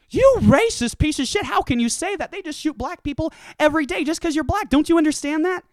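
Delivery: high, loud furious yelling; mock outraged liberal yell; outraged accusation. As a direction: tear into him, self-righteous. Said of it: "calling friend racist in argument".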